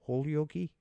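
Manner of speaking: Holyoke as HOLE-ee-OH-kee, rising; trying out pronunciation. Shown as a demonstration. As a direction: say Holyoke with a long O and a final "kee". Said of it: "I know I'm saying it wrong".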